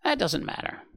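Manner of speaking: high raspy insect voice; rasped. A narrator's reading. Grasshopper dismisses Bambi's apology, rasping. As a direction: rasp it out high, brushing it off. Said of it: "the grasshopper rasped".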